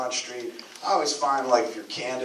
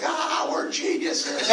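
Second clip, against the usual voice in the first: smug boast; much slower than usual. Retold or imitated. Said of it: "these cats are like".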